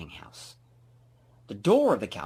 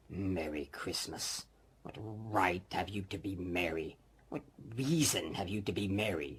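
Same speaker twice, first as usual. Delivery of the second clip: put-on sour old miser; 2 semitones lower, 5 dB quieter than usual. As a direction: sneer at the word merry. Scrooge sneering at nephew.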